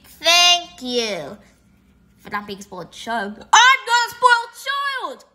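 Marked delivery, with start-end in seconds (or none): 3.4-5.2 high, loud indignant yell; indignant shout. Acted. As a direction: shout back offended. Indignant retort.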